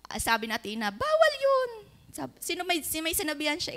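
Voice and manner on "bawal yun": put-on high squeaky child voice saying no; a little girl telling off an adult, pitched way up; child's scolding. Child scolding about swearing. (boses ng batang nagsasaway, mataas)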